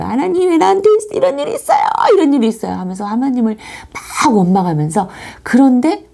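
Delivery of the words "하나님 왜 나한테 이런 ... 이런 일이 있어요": much higher, fast pleading protest; anguished reproach. Retold or imitated. A bereaved writer reproaching God in her diary, pleading high and fast. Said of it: "하나님을 마음껏 원망했어요".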